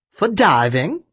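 excited grandmother, lightly performed; excited grandma. A narrator's reading. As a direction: show off a gift with a touch of excitement. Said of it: "she said excitedly".

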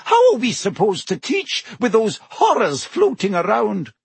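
high, indignant professor; sharp. A narrator's reading. Professor protests about teaching among Dementors, sharp. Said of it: "said Professor McGonagall sharply".

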